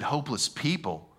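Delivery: ordinary delivery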